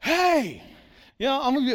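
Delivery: a sudden high, loud "Hey!"; the heckler shouting across the room; mock yell. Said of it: "some guy yells out".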